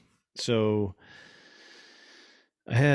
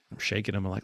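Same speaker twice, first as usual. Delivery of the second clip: normal talking voice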